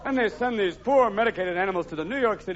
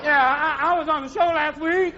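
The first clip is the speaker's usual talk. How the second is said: drugged animal; 7 semitones higher than usual. Retold or imitated. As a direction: play a groggy zoo animal, pitched up. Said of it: "the animals come back next week going".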